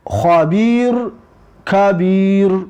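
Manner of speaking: خبیر rasped, then کبیر with a hard k; kh versus k. Shown as a demonstration. Teacher reading a minimal pair for learners, careful. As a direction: contrast خبیر and کبیر clearly. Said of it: "حرف کاف قطعی کبیر".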